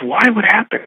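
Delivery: imitated girlfriend, high surprised questions; surprised concern. Girlfriend reacting to the caddie's fear of firing, surprised. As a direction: retell her alarm with the pitch jumping up.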